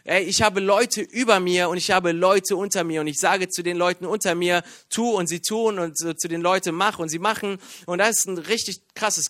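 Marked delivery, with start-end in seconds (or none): none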